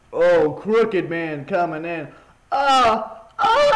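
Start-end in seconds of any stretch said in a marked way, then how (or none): 2.5-3.7 high, loud screams. Real, not acted. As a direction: scream out at a sudden scare. Reacting to a game scare.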